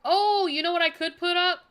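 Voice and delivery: sudden loud high burst of an idea; loud, high eureka shout; eureka excitement. Real, not acted. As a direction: blurt it out like a lightbulb just went on. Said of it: "I just had a eureka moment".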